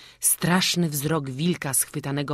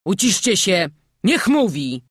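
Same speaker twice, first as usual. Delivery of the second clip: loud, higher order to hush; commanding; 4 semitones higher, 8 dB louder than usual. A narrator's reading. Burmistrz silences the crowd so the stranger can speak, commanding. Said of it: "nakazał burmistrz".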